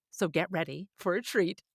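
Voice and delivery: ordinary talk, nothing marked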